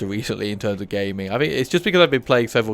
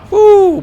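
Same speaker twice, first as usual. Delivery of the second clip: high yelp of alarm; sudden high woo; startled whoop; 15 dB louder than usual. Real, not acted. Startled shout in fight.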